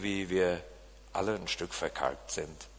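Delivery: normal talking voice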